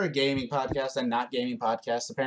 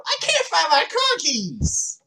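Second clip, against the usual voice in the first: high, slow whiny wail; mock the stranger's high drawn-out whine; guy behind them; 12 semitones higher, much slower than usual. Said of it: "we just hear someone behind us go".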